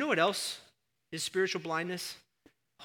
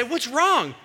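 loud, high exclaimed question; retold alarmed question; 10 semitones higher, 13 dB louder than usual. Husband to his crying wife on the phone, loud retold alarm. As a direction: re-enact a startled shout down the phone.

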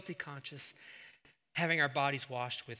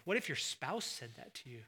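ordinary talk, nothing marked

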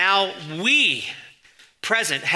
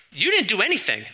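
higher-pitched, incredulous retort; mock protest; 6 semitones higher than usual. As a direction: lightly push back at a boasting fan. Acted retort to boasting fan.

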